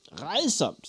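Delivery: gleeful, high exclamation; old witch delighted by disgust; laughing witch. A narrator's reading. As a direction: relish the awfulness, amused. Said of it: "sagde den gamle kone og lo".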